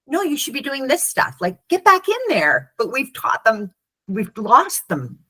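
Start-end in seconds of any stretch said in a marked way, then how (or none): 0.0-2.7 high, pushy mock teacher; mock-scold the kids back in line; high, insistent imitation of a nagging grown-up; bossy adult. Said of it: "we're like".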